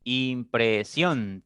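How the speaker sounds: slow "Impresión", each syllable held; "Impresión" stretched into three beats. Shown as a demonstration. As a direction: sound out "Impresión" as Im, pre, sión. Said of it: "Tiene tres sílabas".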